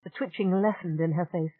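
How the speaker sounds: usual speaking voice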